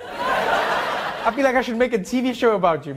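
ordinary talk

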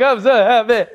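high, loud blubbering; hammed-up tears of joy; mock happy crying. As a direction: wail it through exaggerated sobs. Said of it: "What are you crying about?".

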